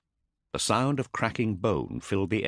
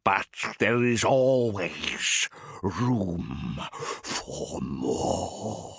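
gurgling demon; much slower than usual. Acted. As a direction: let the hunger drip from it. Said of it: "the thing gurgled".

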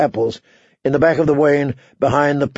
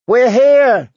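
put-on high, loud little girl's cry; thin, scared shout; 9 semitones higher, 5 dB louder than usual. A narrator's reading. Girl calling toward the castle, shouting high and scared. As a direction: call out in a frightened child's thin voice. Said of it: "she shouted".

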